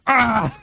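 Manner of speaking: high, mock refreshed "ah"; imitated "ah". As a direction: imitate a big "ah" after a swig. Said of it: "and then he went".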